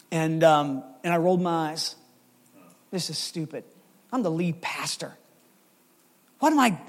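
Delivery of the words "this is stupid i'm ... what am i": grumbling to himself. Retold or imitated. Pastor voices his grumbling thoughts.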